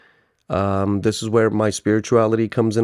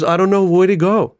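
high, louder mock-distressed plea; imitated crying caller; 8 semitones higher, 5 dB louder than usual. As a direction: play a desperate, tearful caller. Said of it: "Like, he's really crying".

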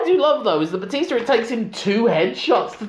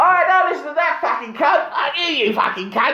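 mock fan voice; 9 semitones higher than usual. Mimicking fan voice.

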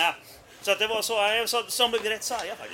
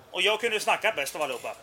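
normal talking voice

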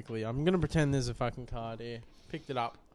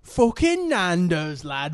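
loud, slow British lad voice; rowdy jeering pub banter; 4 semitones higher, 6 dB louder, slower than usual. Pretend. Mock UK lad chant.

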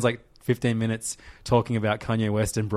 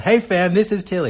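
high, raised voice to be copied; nice and loud; 8 semitones higher, 4 dB louder than usual. Shown as a demonstration. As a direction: model it loudly and clearly higher. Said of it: "just nice and loud".

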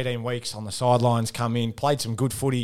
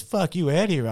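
mock taunt; 6 semitones higher than usual. Teammate's taunt retold.

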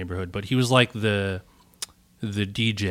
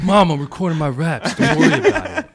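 laughing cool guy; 7 semitones higher, 6 dB louder than usual. Pretend. Acting cool to mom, laughing.

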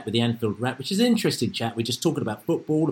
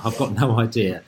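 usual speaking voice

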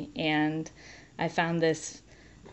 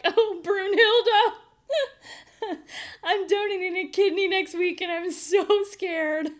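breaking into tears; 13 semitones higher than usual. Retold or imitated. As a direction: voice cracking as she sobs out her fear. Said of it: "And I just broke".

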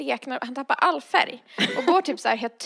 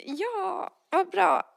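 high, strained mock delight; sugary fake gratitude; fake cheerful; 8 semitones higher, much slower than usual. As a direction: chirp it with forced brightness. Fake cheer at unwanted ticket plan.

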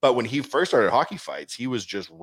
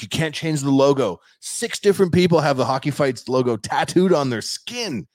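raised, louder imitation of the owner; mock adamant protest; 5 semitones higher, 5 dB louder than usual. Owner forbidding the buyer a logo change, mock adamant protest. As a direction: insist as if guarding something precious.

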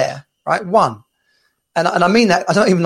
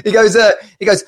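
ordinary talk, nothing marked

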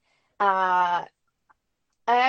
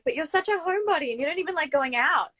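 higher, quicker voice imitating surprised friends; mock disbelief in a rushed, raised pitch; shocked protest; 7 semitones higher, faster than usual. Friends doubting a homebody's move to New York, imitated shock. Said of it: "it really came as a shock to a lot of people".

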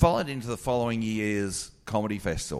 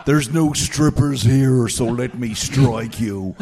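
lightly voiced cheeky challenge, a bit louder; mock game challenge; 2 semitones higher, 6 dB louder than usual. Comic quoting Callan before the slap, mild playful voice. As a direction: tease him into the game, a touch slow. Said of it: "he's like".

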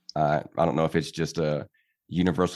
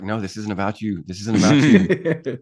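laughing self-scolding; 4 semitones higher, 6 dB louder than usual. Retold or imitated. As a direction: scold yourself while laughing. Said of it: "I'm like".

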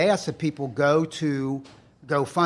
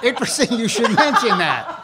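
loud, higher, laughing lead-in; 9 semitones higher, 6 dB louder than usual. Real, not acted. Setting up a joke for the hosts, laughing. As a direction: chuckle into the setup.

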